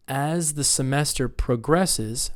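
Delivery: slow model phrase, "progresses" as proGRESSes; second-syllable stress. Shown as a demonstration. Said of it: "verbs on the second".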